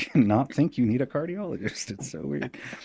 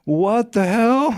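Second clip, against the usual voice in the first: alarmed; 13 semitones higher, 9 dB louder, much slower than usual. Retold or imitated. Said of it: "I'm like".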